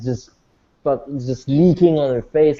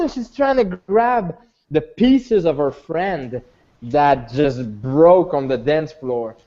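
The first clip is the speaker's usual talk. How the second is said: ordinary delivery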